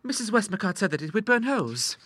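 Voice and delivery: put-on high girlish voice; blithely pleased young lady; untroubled daughter. A narrator's reading. Ida citing her mentor to her father, blithely.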